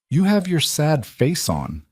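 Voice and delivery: put-on a small girl stating it plainly; little girl voice. A narrator's reading. Child tells dad he looks sad.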